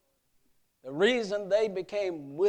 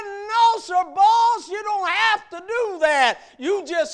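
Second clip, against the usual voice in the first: much higher and louder calling out; alarmed shout; 11 semitones higher, 11 dB louder than usual. Acted. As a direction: shout him back in alarm. Sam calling him back.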